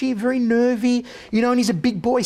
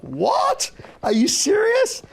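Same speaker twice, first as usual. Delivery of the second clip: much higher, slowed, incredulous yelp; mock-astonished double take, pitched way up; shocked disbelief; 14 semitones higher, much slower than usual. Host retelling his reaction to the hidden guns, a much higher, astonished double take.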